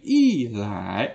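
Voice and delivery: "i lai" slowly, falling "i", rising "lai"; fourth tone before second. Shown as a demonstration. Teacher showing the changed tone, "i lai" falling then rising. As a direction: drop "i", then lift "lai" gradually. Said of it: "maka i ini juga dibaca i".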